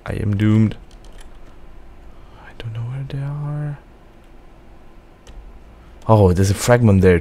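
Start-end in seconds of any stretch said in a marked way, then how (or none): 2.4-3.8 whispering. Real, not acted. Hiding from enemies in game.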